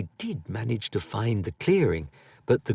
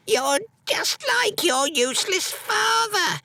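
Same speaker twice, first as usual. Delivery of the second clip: put-on much higher, harsh old mother's cry; exclaimed. A narrator's reading. Angry mother shouting at her son about his father. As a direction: spit it at him like a bitter crone. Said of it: "exclaimed Mother".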